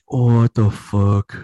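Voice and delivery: very slow, stretched reaction; drawn-out disbelief. Retold or imitated. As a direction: drag it out, stunned. Reacting to horse-person offer.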